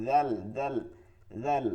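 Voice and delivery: "জাল" said soft and thin; gentle thin "জাল"; soft thin zal. Shown as a demonstration. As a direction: keep "জাল" light, with a soft lisped first sound. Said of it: "একটা নরমও করতে হবে".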